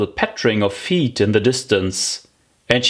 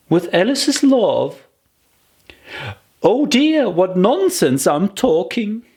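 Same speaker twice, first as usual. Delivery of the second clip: put-on high, amused girl's voice; a little girl tickled by her own silly idea; Alice's voice; 9 semitones higher than usual. Alice laughing at her own nonsense, high and amused. Alice muses on sending presents.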